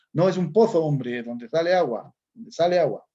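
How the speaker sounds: mock a Spaniard from the peninsula, Spanish accent, th in "pozo"; Spaniard's accent. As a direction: Spain Spanish accent, "pozo" with a lisped th sound. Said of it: "un español, dijera".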